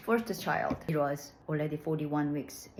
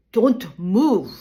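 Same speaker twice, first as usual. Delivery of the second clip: much louder, clipped command; stern order; 5 semitones higher, 15 dB louder than usual. Retold or imitated. Doctor ordering the patient during the epidural, stern and loud. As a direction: bark it as a firm warning. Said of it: "He kept saying it sternly".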